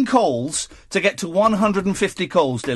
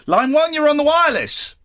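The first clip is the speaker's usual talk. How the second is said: radio host announcing; 9 semitones higher, 4 dB louder than usual. Acted. Host's radio call-in announcement.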